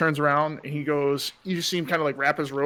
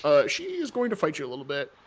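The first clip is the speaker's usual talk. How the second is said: normal talking voice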